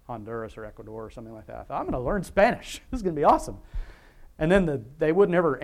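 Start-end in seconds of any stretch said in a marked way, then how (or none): 1.8-3.6 higher, louder burst of enthusiasm; mock-eager announcement with a laugh; retold eagerness. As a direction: play your own naive excitement. Quotes his own eager thought.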